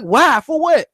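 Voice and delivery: high-pitched retold bafflement; agitated. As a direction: demand answers in mock agitation. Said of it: "I was getting agitated".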